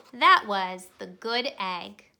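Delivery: ordinary delivery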